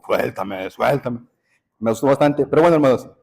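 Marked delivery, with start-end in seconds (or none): 0.0-1.2 imitated child with voice wrecked by screaming; a milder possessed-sounding demand; strained changed voice. Father imitates his son after the vocal cords gave out, strained eerie plea. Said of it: "de tanto gritar, las cuerdas bucales".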